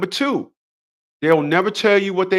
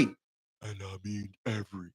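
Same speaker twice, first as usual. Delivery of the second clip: very low, quiet, dragged "and I mean every"; deliberately deep bass delivery; 11 semitones lower, 20 dB quieter, slower than usual. Shown as a demonstration. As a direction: drop into a deep rumbling voice for "every". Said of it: "That was my deep voice".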